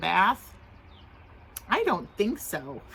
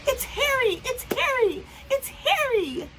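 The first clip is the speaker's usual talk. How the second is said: high, loud calling out; excited shout; 13 semitones higher, 3 dB louder than usual. Acted. Children calling their parents to see Harry, excited shout. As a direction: call the parents over, thrilled. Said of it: "they began to shout".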